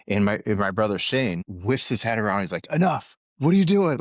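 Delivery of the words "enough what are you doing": brother's sharp, high yell imitated; retold angry yell. Brother yelling at the chirping older guy, angry retold shout. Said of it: "just starts yelling at the guy".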